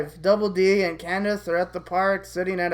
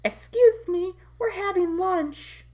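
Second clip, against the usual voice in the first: much higher, prim indignant voice; indignant Candace; 12 semitones higher, slower than usual. Acted. Candace to intruding Marie, high prim indignation. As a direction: object in a put-on girlish voice.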